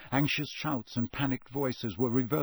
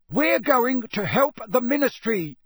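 high, loud shout over the din; father shouting over the noise to his sons; 11 semitones higher, 7 dB louder than usual. Acted. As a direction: yell above the chaos. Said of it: "Mister Weasley shouted over all the noise".

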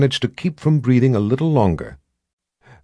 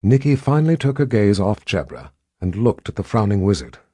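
ordinary delivery

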